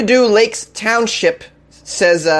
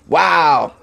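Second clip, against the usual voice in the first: ordinary talk, nothing marked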